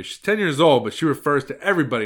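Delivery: ordinary delivery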